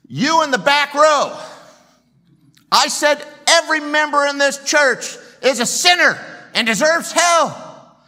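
high, loud shout at the back row; shouting preacher. Retold or imitated. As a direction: yell straight at the man in back. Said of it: "I'll try the direct approach".

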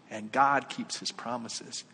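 ordinary talk

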